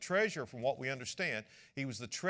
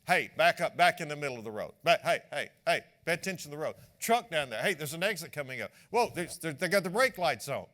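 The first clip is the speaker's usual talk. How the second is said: louder, quick calls from the passenger seat; urgent backseat warning; 7 dB louder, faster than usual. Retold or imitated. Passenger warning the weaving driver, loud and urgent. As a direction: bark at the driver to watch the road.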